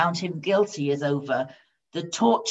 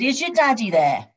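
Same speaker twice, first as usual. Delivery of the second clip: higher-pitched question, mildly put out; a bit put out; 6 semitones higher, 4 dB louder than usual. A narrator's reading. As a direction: ask as a slightly inconvenienced boss. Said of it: "feeling a bit put out".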